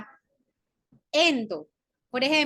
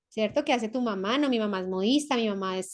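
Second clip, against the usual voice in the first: ordinary delivery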